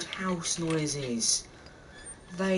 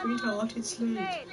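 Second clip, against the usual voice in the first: mock mom; 7 semitones higher, 3 dB quieter, slower than usual. Voicing the mom answering Molly.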